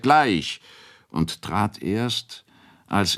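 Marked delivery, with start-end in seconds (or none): 0.0-0.6 higher-pitched quick call; called-out reply, raised in pitch. A narrator's reading. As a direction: call it out to someone in the next room. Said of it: "rief der".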